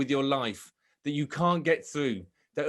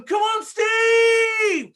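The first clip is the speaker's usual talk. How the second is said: long, high, drawn-out cheering shout; crowd scream; 9 dB louder, much slower than usual. Retold or imitated. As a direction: roar his name like a whole grandstand. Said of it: "everybody screaming my name".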